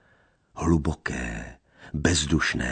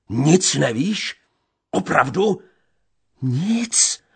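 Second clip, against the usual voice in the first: shrill questioning; 12 semitones higher, 5 dB louder, slower than usual. A narrator's reading. Fierce questioning and denial.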